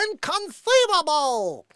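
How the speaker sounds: high slow indignant cry. Retold or imitated. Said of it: "remember Princess Bride".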